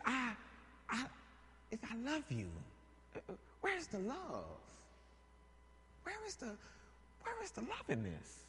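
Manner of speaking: soft, high, hurt pleading; plaintive Delilah. Acted. Delilah asking Samson where the love is, soft high hurt plea. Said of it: "She says".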